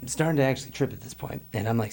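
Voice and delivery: normal talking voice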